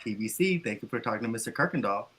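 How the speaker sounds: low, soft office phone greeting; passive, low professional phone voice; inviting work voice. Shown as a demonstration. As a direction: answer the work line softly and unaggressively. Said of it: "I have a more inviting".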